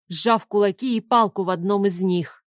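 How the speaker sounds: plain reading voice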